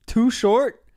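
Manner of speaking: can't believe the name you're reading; surprised disbelief. Real, not acted. Streamer reading the cast list in sudden disbelief.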